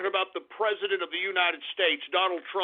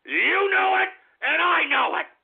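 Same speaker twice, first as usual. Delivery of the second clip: shouted high and louder at the trolls; angry yell; 7 semitones higher, 4 dB louder than usual. Real, not acted. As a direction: bark it back at them, triumphant. Ranting at internet trolls.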